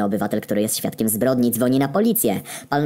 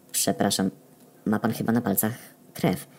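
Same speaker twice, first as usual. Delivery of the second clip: soft, feigned innocent question; innocent asking; 4 dB quieter than usual. Acted. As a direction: play dumb, sweetly. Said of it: "Spytałem niewinnie". (niewinnie, udawane pytanie)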